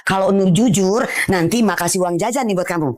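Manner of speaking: low, reassuring mother; coaxing. Acted. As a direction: gently talk a child into confessing. Mother coaxes child to confess.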